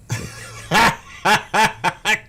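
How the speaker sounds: high giggling laugh breaking the act; laughing fit. Real, not acted. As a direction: crack up laughing mid-impression. Breaks up laughing mid-impression.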